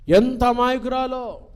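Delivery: ఎంత hit high and loud. Shown as a demonstration. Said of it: "repeated phrase".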